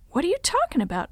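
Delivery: high-pitched puzzled question; puzzled, pitched well up; quizzical. A narrator's reading. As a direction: ask it in bafflement. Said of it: "Uncle Earl asked quizzically".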